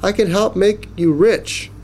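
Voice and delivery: high, urgent pleading offer; mild yell. A narrator's reading. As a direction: bargain quickly with a hungry giant. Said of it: "Jackie yelled".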